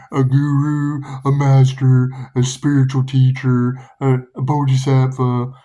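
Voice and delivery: loud, intoned spiritual-teacher voice; mock holy man. Reader mocks ideas of a holy person, grand put-on voice. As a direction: boom each title out with mock grandeur.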